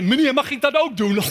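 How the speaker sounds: put-on a little kid asking a stranger if they can have a go, high and eager; child asking in Dutch. Father voicing Dutch kids asking to stick brushes on his head, high and eager.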